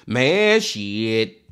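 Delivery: normal talking voice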